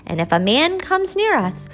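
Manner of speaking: a small mouse reciting the lesson brightly; little mouse child. Acted. Little mouse answering its mother, eager child voice.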